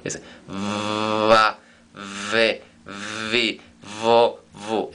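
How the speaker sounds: teacher modelling va, ve, vi, vo, vu for the class; v sound drill. Shown as a demonstration. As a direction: drill each syllable slowly, buzzing the v. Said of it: "Es labio dental, vibrativa".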